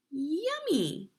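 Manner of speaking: high, lightly voiced queen relishing it; delighted queen. Queen tasting the toffee cake, pleased croon.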